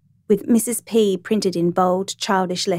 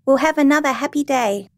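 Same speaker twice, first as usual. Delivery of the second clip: put-on higher-pitched child calling after someone; 6 semitones higher than usual. A narrator's reading. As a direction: call it out like a happy little girl. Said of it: "she called".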